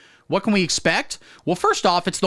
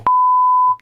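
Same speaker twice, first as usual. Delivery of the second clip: shrill laugh burst; 9 dB louder than usual. Real, not acted.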